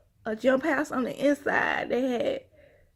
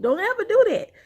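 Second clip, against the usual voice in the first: sharp, loud and high warning; fierce warning; 8 semitones higher, 8 dB louder than usual. Real, not acted. Mother warning men off her child, loud and fierce. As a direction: put your foot down hard.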